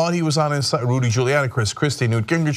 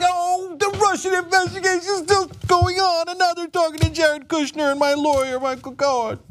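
high whiny crybaby voice, slowing at the end; whiny child; 12 semitones higher than usual. Retold or imitated. Host mocking the president as a sulking child, high and whiny. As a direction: whimper it like a sulking child, pitched way up. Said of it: "they're like".

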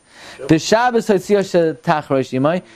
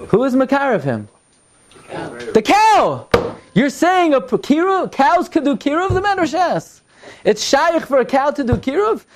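astonished question; 10 semitones higher than usual. Acted. Voicing the Medrash's astonished question.

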